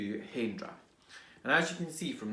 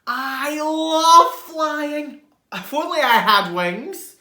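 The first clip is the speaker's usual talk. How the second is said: loud, high, slow delight; loud joyful wonder; thrilled; 10 semitones higher, 13 dB louder, slower than usual. Acted. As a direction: gush with joy. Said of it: "said Norman".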